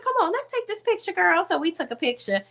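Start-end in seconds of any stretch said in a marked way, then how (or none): 0.0-1.4 eager invitation. Retold or imitated. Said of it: "She was like".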